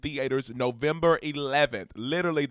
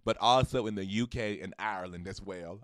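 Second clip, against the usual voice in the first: ordinary talk, nothing marked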